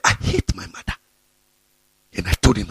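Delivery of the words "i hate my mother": whispered quote of the man; hushed confession. Retold or imitated. Pastor retelling a man's confession, whispering it.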